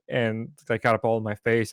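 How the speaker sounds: normal talking voice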